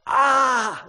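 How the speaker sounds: high, loud "Ah!" yell; imitated yell. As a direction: let out a startled-sounding yell. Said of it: "lets out this yell".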